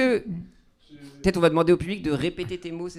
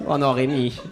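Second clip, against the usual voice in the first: normal talking voice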